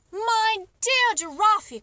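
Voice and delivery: slow, high and loud, arms wide open; cried out. Acted. Duke greeting the giraffe, calling out slowly and loudly. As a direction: stretch out my dear Giraffe with delight. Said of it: "cried the Duke".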